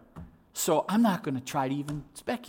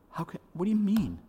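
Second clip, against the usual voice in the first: quick, higher-pitched baffled question; incredulous and hurried; acted disbelief; 5 semitones higher, faster than usual. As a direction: blurt it out as a baffled listener.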